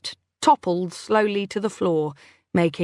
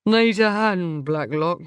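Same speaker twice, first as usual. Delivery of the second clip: slurred. A narrator's reading. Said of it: "slurred a voice behind me".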